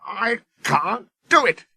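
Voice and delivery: grumbling. A narrator's reading. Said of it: "he grumbled".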